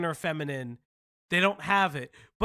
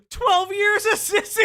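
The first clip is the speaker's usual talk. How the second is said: high, loud gasp into laughing yell; laughing outburst; 11 semitones higher, 6 dB louder than usual. Real, not acted. Streamer bursting out laughing at the clip, loud and high. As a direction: blurt it out mid-laugh.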